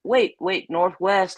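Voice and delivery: ordinary talk, nothing marked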